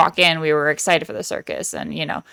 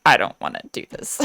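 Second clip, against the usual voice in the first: much lower-pitched, dejected grumble; mock dejection; 10 semitones lower than usual. Retelling the janitor's first scene, dejected refusal. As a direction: drop the pitch and sound defeated. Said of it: "it's very much the dejected".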